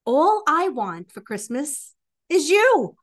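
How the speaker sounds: usual speaking voice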